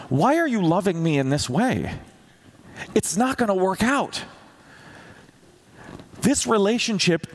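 despairing cry to God, voice climbing; panicked prayer. Retold or imitated. Preacher retelling his panicked prayer to God, pitched high and pleading. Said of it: "I remember having that kind of panic".

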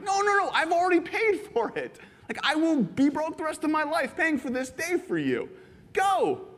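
high, urgent insistence; pleading insistence. Retold or imitated. Host insisting the visitor go in, high and urgent. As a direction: push them toward the gift.

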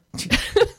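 high burst of laughter. Real, not acted. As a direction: cracks up laughing. Laughing at the your-mother joke.

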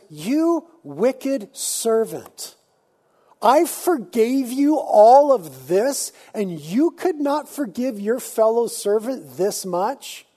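the furious king dressing down his servant; incensed king. Retold or imitated. The pastor's king rebukes the wicked servant, slow and incensed. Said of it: "And the king is incensed".

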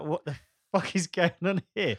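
incredulous outburst, high and loud, breaking into a laugh; laughing what-the-hell outburst; laughing mock exasperation. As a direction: blurt it out laughing in disbelief. Two dudes reacting to shower podcast.

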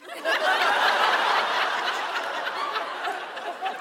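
high unvoiced laughter; breathy laugh. Real, not acted. Laughing at own story.

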